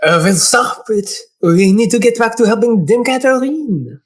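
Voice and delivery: put-on insistent French accent; French-accented urging. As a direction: scold as the French assistant. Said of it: "He's gonna be French".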